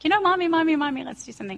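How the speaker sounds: put-on high, whiny little boy plea; pleading little boy. Mother imitates her young son begging her to play, high whiny. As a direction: beg mommy to play like a small child. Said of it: "He'd be like".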